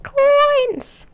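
high, squeaky cartoon cry of joy; squeaky coins cry. Real, not acted. Gamer squealing with glee over coins. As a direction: squeal the word like a cartoon character.

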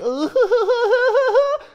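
high, loud, wavering shriek; panicked squeal. Real, not acted. Player trying to escape, squealing in panic. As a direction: squeal while fleeing.

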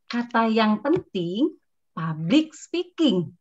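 "public speaking" slowed and set apart in tone; teacher showing key-word stress. Shown as a demonstration. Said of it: "Nadanya harus beda".

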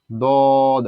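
দদ held long, final d bounced; four-beat steady দদ; elongated with qalqala. Shown as a demonstration. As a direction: stretch দদ on one note and release the d. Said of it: "টানতে হবে চার আলিফ".

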